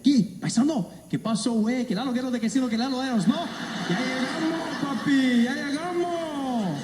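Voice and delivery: high-pitched Mexican Spanish, eager and friendly; Mexican stranger greeting. Retold or imitated. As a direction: play a chummy Mexican guy who thinks you're one of his own. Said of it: "He's like".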